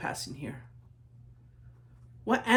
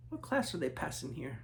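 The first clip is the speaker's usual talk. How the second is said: ordinary delivery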